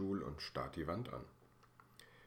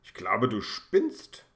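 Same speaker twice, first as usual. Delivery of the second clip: loud, slow, outraged rebuke; indignant; 4 semitones higher, 11 dB louder, slower than usual. Acted. Indignant father scolds Ben for the prank, loud and slow. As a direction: drag it out in disbelief. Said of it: "Herr Funke stemmt empört die Hände in die Hüfte".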